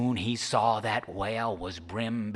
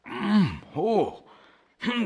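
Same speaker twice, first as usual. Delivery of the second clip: groggy Charlie grunts; 11 semitones higher, 5 dB louder, slower than usual. Acted. Charlie waking confused.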